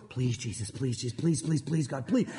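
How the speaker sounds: low, hushed, frantic pleading; mock desperate muttered prayer, repeating under the breath; panicked begging. Moses turns around to God, hushed mock-panicked begging.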